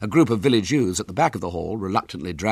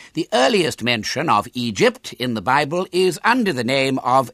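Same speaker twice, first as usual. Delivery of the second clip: raised, over-precise lecture voice; pompous professor lecturing; 5 semitones higher, 5 dB louder than usual. Acted. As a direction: declaim it ponderously to a hall. Professor Smith begins his lecture.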